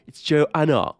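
high, hammed-up grand voice; mock indignation at being taken for common; mock haughty. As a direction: play the offended snob, drawing it out. Acts out the offended Joanne.

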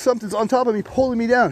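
high, strained, urgent report; imitated scared friend. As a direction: mimic a frightened friend calling for help. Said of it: "He was so scared".

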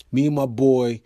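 ordinary talk

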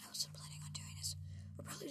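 low whispered aside; whispering. Real, not acted. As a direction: whisper it quietly. Aside while others talk.

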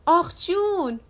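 put-on high, loud childish cheer; gleeful shout. A narrator's reading. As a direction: shout it like delighted kids. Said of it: "دو قلوها داد زدن".

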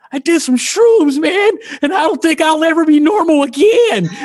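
high, hammed-up tearful wail; crying friend. Friend tripping on shrooms confesses to her, mock crying. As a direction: imitate a friend sobbing in a panic. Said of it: "he's crying".

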